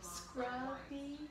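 barely audible whisper. Real, not acted. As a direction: breathe the word softly. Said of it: "doing the whisper challenge".

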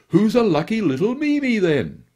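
put-on high, loud cooing baby talk; doting baby talk. A narrator's reading. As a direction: gush over the little dog like a doting owner. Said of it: "he murmured to the gobbling animal".

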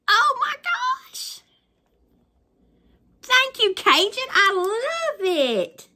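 2 high, slow, delighted thanks; 3 gush thank-yous to a friend; 4 thanking her friend, high and drawn out. Real, not acted. Gushing thanks.

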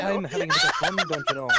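giggling fake posh Brit; squeaky hammed-up posh British; mock posh British.

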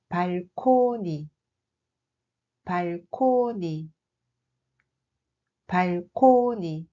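slow, careful "balcony" said as "palkoni" with a P; Korean loanword pronunciation. Shown as a demonstration. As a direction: model "balcony" slowly, as "palkoni". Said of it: "Le mot suivant est". ("balcony" prononcé lentement « palkoni »)

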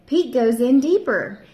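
plain reading voice

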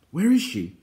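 high, loud urgent question; excited cry. A narrator's reading. Taran asking after the lost pig, loud urgent cry. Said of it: "cried Terran".

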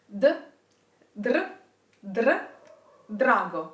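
d then dr then drago, trilled r; rolled r exercise. Shown as a demonstration. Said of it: "start pronouncing a d and then add the r".

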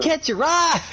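high-pitched mock catchphrase call; Borderlands character holler. Player repeating the Borderlands catchphrase, hammed-up holler. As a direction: call it out like a cartoon hawker.

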